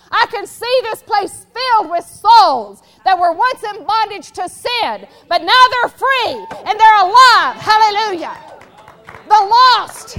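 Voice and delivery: loud, rising sermon climax; full-force preaching. Acted. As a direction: preach it out with fervour.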